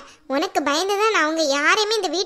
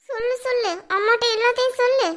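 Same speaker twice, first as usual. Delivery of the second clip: mocking taunt; 5 semitones higher than usual. Wife mocks husband calling mother.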